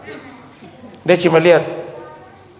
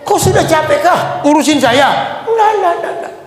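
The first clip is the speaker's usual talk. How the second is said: wife snaps back in offended shout; indignant shout; 12 semitones higher than usual. Acted. Wife and prophet quarrel acted. (teriakan protes istri)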